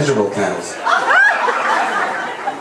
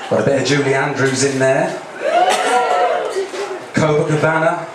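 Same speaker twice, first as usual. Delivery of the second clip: ordinary talk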